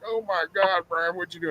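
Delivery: high, hushed mock horror; shocked girlfriend. Girlfriend at the jail visit, hushed shocked voice. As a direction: imitate her stunned horror. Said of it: "she's like".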